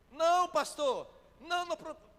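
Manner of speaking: wife reassuring the pastor, high and urgent; anxious denial. Retold or imitated. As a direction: rush to deny, alarmed. Preacher voices woman denying worriedly.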